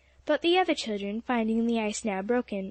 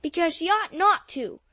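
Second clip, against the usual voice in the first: howled; 6 semitones higher, 4 dB louder than usual. A narrator's reading. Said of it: "howled Joel sturdily".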